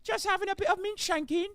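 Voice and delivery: high, hammed-up Cockney geezer accent; mock British cop. Streamer mocks British police in Cockney accent. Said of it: "the British authorities be like".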